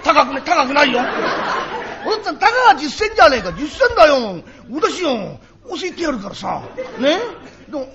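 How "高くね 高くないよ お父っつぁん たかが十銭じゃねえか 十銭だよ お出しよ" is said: higher, louder, fast wheedling; pushy haggling. Acted. Child to father, pushing for ten sen. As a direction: press him for the coin.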